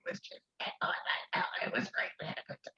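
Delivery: hoarse voiceless whisper; barely any voice left; lost voice. Shown as a demonstration. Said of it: "I had lost my voice".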